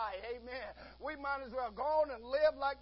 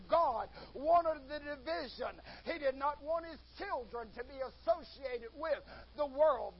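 ordinary delivery

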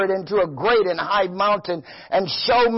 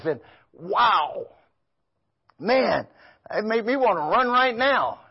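ordinary delivery

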